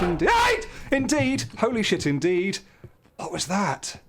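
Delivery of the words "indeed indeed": words leaping into a high scream; jolted by a fright mid-phrase; startled shriek. Real, not acted.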